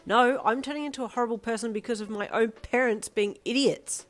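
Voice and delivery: shouting. A narrator's reading. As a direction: yell back at her mother. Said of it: "you shouted".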